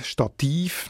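Stativ stressed on -TIV; accent the final syllable of Stativ; stress on second syllable. Shown as a demonstration. Editor comparing with Latin, Stativ stressed on -tiv. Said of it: "noch die gleiche Betonung".

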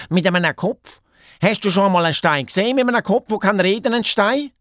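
high, rapid-fire questions; excited hare. Acted. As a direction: press the question eagerly in a high voice. (ufgregte Haas)

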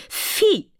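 high-pitched scandalised "Phi"; a squeal of prim disgust. A narrator's reading. Fairy is scandalised by the prince's remark, high squeal. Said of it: "sagte die Undine".

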